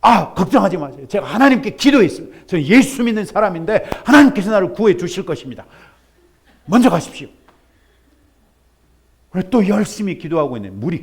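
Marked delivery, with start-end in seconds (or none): 0.0-7.3 loud, fast, self-assured brush-off; confident refusal. Acted. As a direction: wave off the rescue smugly. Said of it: "이 사람이 뭐라 그래요?".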